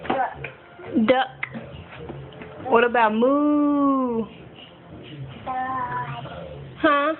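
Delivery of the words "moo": slow, drawn-out pretend moo; cow moo. Quizzing toddler on animals, long playful moo.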